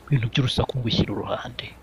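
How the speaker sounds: whispered aside; whispering. Retold or imitated. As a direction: whisper it like in church. Asking for silence in church.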